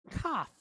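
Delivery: Kaf with a hard, popped K as in king. Shown as a demonstration. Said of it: "Hear the hard K sound".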